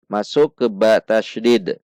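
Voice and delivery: normal talking voice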